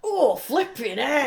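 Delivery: high, loud, drawn-out mock dismay; hammed-up reaction to an awful chat-up line; mock Ivanka.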